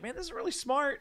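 suddenly seeing how clever it is; impressed realization. Retold or imitated. Watching the movie as a kid, amazed it's smart. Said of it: "it's like".

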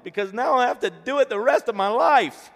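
hammed-up high, loud child's wail; mock sobbing. A narrator's reading. Little girl protesting to her father, mock wailing. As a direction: wail it out at full volume, very high. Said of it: "She broke into a sob".

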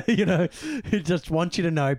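said through a laugh. Real, not acted. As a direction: laugh as you talk. Laughing while retelling the scene.

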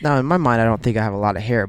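usual speaking voice

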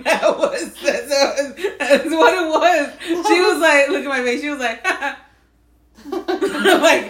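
high, slow words through laughter; can barely get it out for laughing; laughing while talking. Real, not acted. Friend recalling the sudden mood change, laughing hard.

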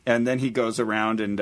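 usual speaking voice

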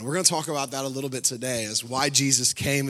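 normal talking voice

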